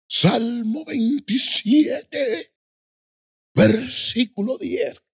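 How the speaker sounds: very high, drawn-out announcing cry; imitated preacher. Preacher announcing the psalm to the village, high slow imitation. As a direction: call it out slowly, stretching every word. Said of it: "así hablaba el hombre".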